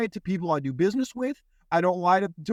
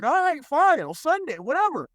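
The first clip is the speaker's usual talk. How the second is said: high-pitched, put-upon surrender; retold reluctant agreement, voice shooting up; exasperated giving in; 10 semitones higher than usual. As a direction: cave in with mock exasperation. Acting out giving in to pressure.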